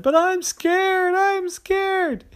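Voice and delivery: high, slow whimpering fear; scared boy. Acted. Terry tells his parents he's scared, high whimper. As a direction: whine your fear out. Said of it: "Terry said".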